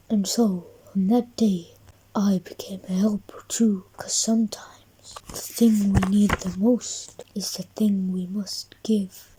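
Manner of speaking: soft whispered ending; a quiet whispered lesson; hushed whisper. A narrator's reading. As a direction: whisper the moral tenderly. Narration's heartfelt closing lines.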